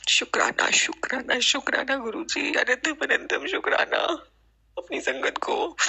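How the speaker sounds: teary, whispered thanks to the guru; emotional thanks. Real, not acted. Devotee thanking guru through tears. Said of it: "मैं emotional हो रही हूँ".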